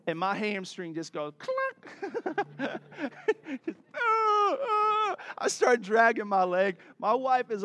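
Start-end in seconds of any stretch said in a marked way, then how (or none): 2.3-3.6 a short laugh at his own mishap; laughing at himself. Real, not acted. 3.9-5.2 high, hammed-up yelps of pain; mock pain cry. Hamstring goes clunk.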